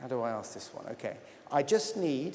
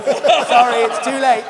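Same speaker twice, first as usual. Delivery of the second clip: laughing; 7 semitones higher, 13 dB louder, slower than usual. Real, not acted. Host laughing at winner mixup.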